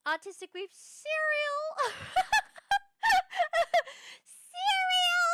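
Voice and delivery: very high, loud squeal with laughter; giddy shriek. Real, not acted. Streamer reacting to cereal comment, giddy shriek. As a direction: squeal it out, cracking up.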